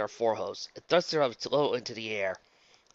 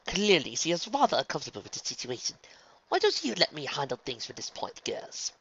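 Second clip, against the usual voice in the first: higher, poised character voice; tactful, commanding Rarity; 4 semitones higher than usual. Acted. Rarity dismissing the twins, politely firm. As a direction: take charge politely.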